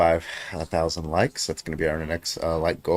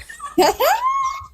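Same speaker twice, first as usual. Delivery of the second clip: loud laugh; 5 dB louder than usual. Real, not acted. Group laughing at story.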